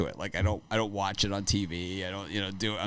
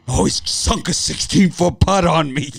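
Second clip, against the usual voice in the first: mock scared whine; 8 semitones higher, 10 dB louder than usual. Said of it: "What are you gonna do?".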